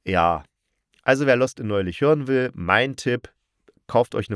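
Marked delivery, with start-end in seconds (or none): none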